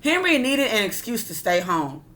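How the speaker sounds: ordinary delivery, nothing marked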